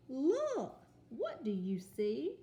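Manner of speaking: exclaim, then ask; excited then asking. Shown as a demonstration. Teacher rereading the title with expression for kids. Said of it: "so say it with excitement".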